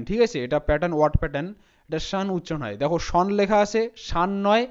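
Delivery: ordinary talk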